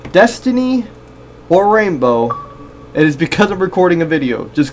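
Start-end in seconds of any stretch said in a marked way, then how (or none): none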